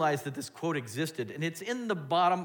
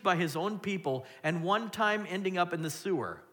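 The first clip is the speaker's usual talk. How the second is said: preaching; 4 semitones higher than usual. Acted. Preaching at full force.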